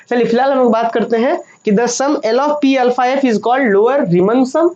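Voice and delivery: normal talking voice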